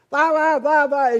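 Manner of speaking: high, loud blah-blah imitation; mock loud chatter. Man standing over a passenger on his phone, mock talk-yelling. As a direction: mimic an oblivious phone yeller. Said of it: "he's just talking".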